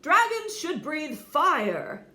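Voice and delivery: angry father insisting; insistent. Acted. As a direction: refuse to accept it. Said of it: "insisted Crispin's father".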